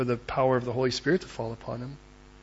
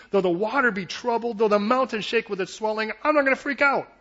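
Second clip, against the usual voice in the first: higher-pitched, driving sermon delivery; rising fervour, phrase on phrase; impassioned preaching; 7 semitones higher, 4 dB louder than usual. Acted. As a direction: preach it with building conviction.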